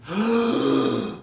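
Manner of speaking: a sharp, soft intake of breath; deep revival gasp. Shown as a demonstration. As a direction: gasp like someone pulled from the water. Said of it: "and taking these breaths".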